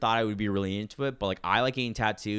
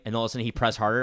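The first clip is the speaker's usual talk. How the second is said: ordinary talk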